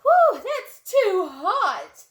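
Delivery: high, loud, slow yelp; startled, too hot. A narrator's reading. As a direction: recoil from burning porridge. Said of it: "Daisy Locks gasped".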